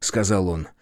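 ordinary delivery, nothing marked

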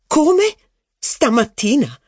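high-pitched, vexed duchess; put out. A narrator's reading. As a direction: query it in displeasure, stretching the second word. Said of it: "disse la duchessa con aria contrariata".

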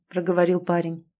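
usual speaking voice

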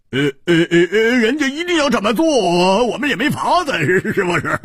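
deliberate sneer. A narrator's reading. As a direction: fake a scornful laugh to goad her. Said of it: "故意冷笑着说". (故意高声冷笑，带着笑挑衅)

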